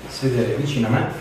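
usual speaking voice

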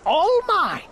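high, triumphant turtle-king outburst; exultant cry. A narrator's reading. Turtle king gloating over his new kingdom, exultant cry. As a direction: cry out, claiming everything. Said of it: "Yertle cried".

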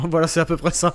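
amused at the mess; laughing. Real, not acted. Rit de son ogre. (en riant, plus fort)

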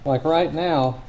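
talking through a face mask; muffled voice behind a mask. Real, not acted. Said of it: "sorry for the muffled tones because I've gotta wear this, this mask".

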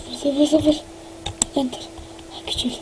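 breathy count, no voice; whisper. Acted. Entering a code in secret, whispering. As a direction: whisper the digits while typing.

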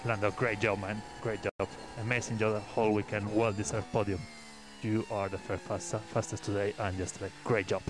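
race car radio. Real, not acted. Team boss over team radio.

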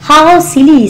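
high, loud exclamation. A narrator's reading. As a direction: scoff loudly, pitched well up. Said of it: "said Alice very loudly".

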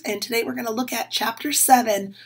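usual speaking voice

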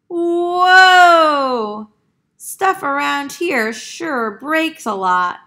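high loud put-on duck yelp, then chatter; the dazed duck shrugs off the crash; cartoon duck, startled. Duck's reaction after falling.

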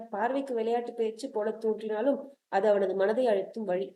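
usual speaking voice

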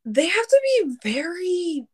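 normal talking voice

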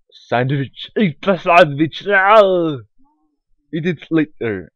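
a clumsy, hammed-up Russian accent, loud and high, slow; bad Russian accent. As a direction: play a strongman badly in a Russian accent. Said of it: "I can't do a Russian accent".

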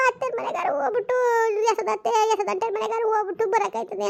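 quoting daughter. Retold or imitated. Mother quotes daughter's wish.

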